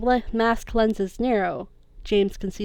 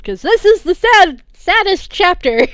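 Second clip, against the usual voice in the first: mock lament; 11 semitones higher, 13 dB louder than usual. Real, not acted. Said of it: "reader's mock lament about chapter".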